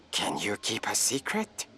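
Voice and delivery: low, soft conspiratorial question; hushed secret. Acted. Elder confides a secret to the visitors, hushed. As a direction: draw them in close and quiet.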